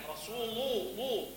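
لو with a heavy, velarized ل, soft and slow; thick lam demo. Shown as a demonstration. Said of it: "تخينة".

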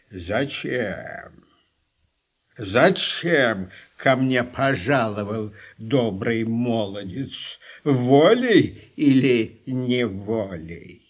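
low, slow, creaky crone; old witch voice. Acted. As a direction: drawl it out like Baba Yaga. Baba Yaga questions the prince.

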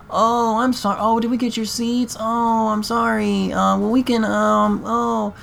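high, drawn-out mock apologetic whine; feigned apology. Feigned apology of seat-taking family.

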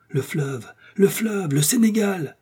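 building from a modest call to a high cry; excited exclamation. Acted. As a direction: cry out the discovery, pitch climbing sharply. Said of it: "le docteur s'écria". (exclamation exaltée qui monte vers l'aigu)

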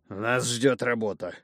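put-on slow, heavy drunken announcement; tipsy father, slurring slowly. Drunk father wakes son.

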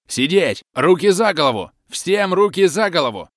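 high, loud barked commands; frantic shouting hostage taker. A narrator's reading. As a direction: roar orders at the passengers. Assault team commanding hostages. (громкие команды, высоко)

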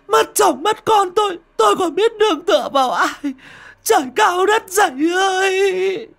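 high, loud anguished wailing; wailing sobs. Acted. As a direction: howl your grief for husband and son. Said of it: "lăn ra đất mà gào khóc". (gào khóc thảm thiết)

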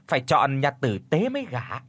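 ordinary delivery, nothing marked

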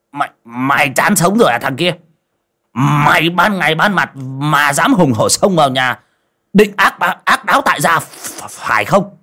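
blustering challenge. A narrator's reading. Coffin maker backed by his workmen challenging the intruder, blustering. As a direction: puff up and scold the intruder, stammering. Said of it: "mới đứng ở bên hè mà hắng giọng".

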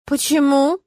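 small, let-down voice; lightly voiced dismay; upset child. A narrator's reading. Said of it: "расстроился ребёнок".

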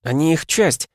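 high shouted cry over the wind. A narrator's reading. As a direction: yell it against the roaring wind. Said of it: "закричала Миас". (крик сквозь ветер)

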